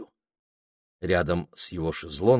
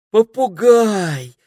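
high, loud, long-drawn cry of delight; overjoyed; 14 semitones higher, 10 dB louder, much slower than usual. A narrator's reading. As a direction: sing out in happy discovery. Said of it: "обрадовалась Пенелопа".

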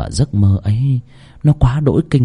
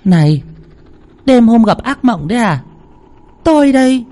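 much higher startled woman; wife's voice; 12 semitones higher than usual. A narrator's reading. Wife startles her husband from his nightmare. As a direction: snap him out of it.

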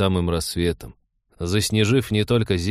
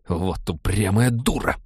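slow, exasperated muttered insult; exasperated outburst; slower than usual. A narrator's reading. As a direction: let it slip out in frustration. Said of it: "вырвалось у хирурга".

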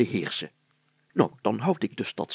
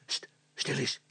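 soft hiss, cut short; hissed hush; 2 semitones higher, 8 dB quieter than usual. A narrator's reading. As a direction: hiss for silence. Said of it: "siste Brilstra".

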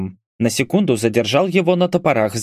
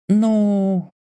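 higher-pitched, lingering hesitation; drawn-out hesitation; 9 semitones higher than usual. A narrator's reading. Girl stalling before answering about payment, drawn out. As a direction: stretch one high syllable out. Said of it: "протянула девушка".

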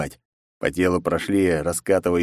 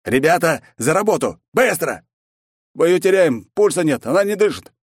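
loud, high urgent doctors; urgent shouting; 10 semitones higher, 5 dB louder than usual. Acted. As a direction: shout orders in a panic.

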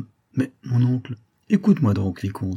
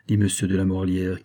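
usual speaking voice

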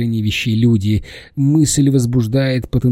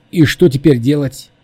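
higher, louder burst of alarm; voiced panic, loud and raised; panicked cry; 5 semitones higher, 7 dB louder than usual. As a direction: call out in panic. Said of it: "вскричал он в панике".

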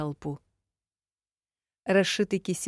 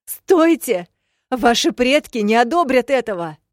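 even higher, louder cry; desperate shout; 8 semitones higher, 10 dB louder than usual. A narrator's reading. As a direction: scream the warning with everything left. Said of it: "сопротивлялась я". (отчаянный крик)

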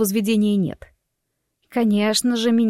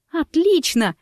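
mildly delighted exclamation, pitched up; sincere delight; 8 semitones higher, slower than usual. A narrator's reading. Wife hearing the playground is approved, sincerely delighted. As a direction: sound genuinely pleased, voice lifting high. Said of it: "искренне обрадовалась я".

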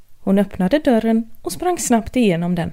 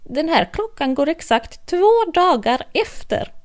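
hatter; 6 semitones higher than usual. Acted. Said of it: "hattaren ... sa".

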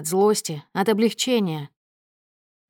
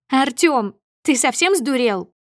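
raised, high indignant voice; outraged outburst; 6 semitones higher, 5 dB louder than usual. A narrator's reading. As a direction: berate your husband in public. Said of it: "Взрывается Снежана так громко". (возмущённо, на повышенных тонах)

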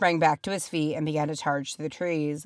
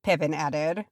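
plain reading voice

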